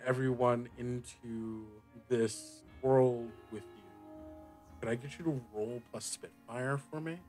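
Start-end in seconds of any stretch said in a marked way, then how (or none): none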